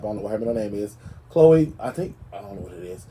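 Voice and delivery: ordinary talk, nothing marked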